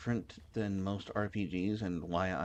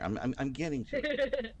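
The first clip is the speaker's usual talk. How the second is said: high playful protest; 8 semitones higher than usual. Real, not acted. Reassuring chat while gaming.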